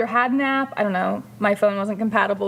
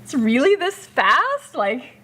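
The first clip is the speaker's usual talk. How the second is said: amazed; 4 semitones higher, slower than usual. Retold or imitated. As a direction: act stunned by the speed. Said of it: "I'm like".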